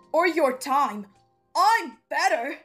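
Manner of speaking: jealous and loud, claim to be the better one; jealous outburst. Acted. Jealous boss competing for her attention, loud insistence.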